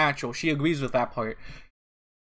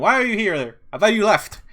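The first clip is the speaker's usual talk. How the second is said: loud, higher-pitched startled question; 7 semitones higher, 9 dB louder than usual. Acted. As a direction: caught off guard, voice jumps up. Reads out a startled line.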